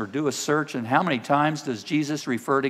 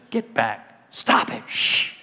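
low, hushed shooing, ending in a shush; hushing rebuke; 4 semitones lower, slower than usual. Acted. Preacher as the crowd silencing blind men, hushed scolding. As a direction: shush them under your breath.

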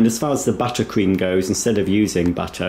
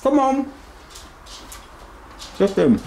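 high coaxing voice. Real, not acted. Coaxing the dog.

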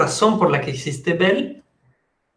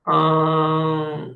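ordinary talk

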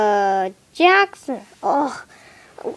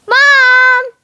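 shouted call to Mom, higher; 8 semitones higher, 6 dB louder than usual. Acted. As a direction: call out for Mom across the house. Calling out to Mom.

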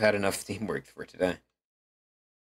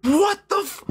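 shocked yell; slower than usual. Real, not acted. Sudden shock at game event.

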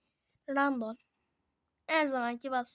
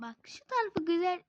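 ordinary talk, nothing marked

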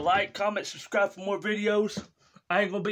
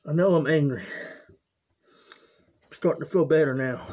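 mellowing addict; 6 dB louder, slower than usual. Acted. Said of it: "the drug and alcohol addict".

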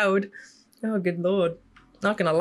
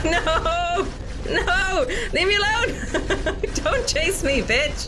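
panicked giggling yell while chased; half scream, half laugh; 9 semitones higher, slower than usual. Real, not acted.

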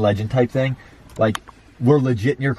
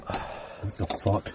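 scared whisper; 10 dB quieter, much slower than usual. Real, not acted. Frightened by figure approaching.

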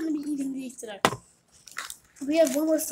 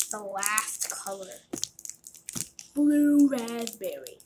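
slow dramatic announcement; much slower than usual. Shown as a demonstration. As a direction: announce it slowly like a reveal. Dramatic last flavor announcement.

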